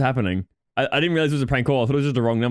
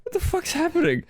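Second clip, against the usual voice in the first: high, confused outburst; baffled. Retold or imitated. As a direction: react like you were just woken by a weird call. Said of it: "I was just like".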